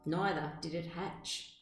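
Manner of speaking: ordinary delivery, nothing marked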